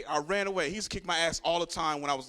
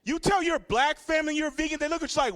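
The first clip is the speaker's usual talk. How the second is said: ordinary talk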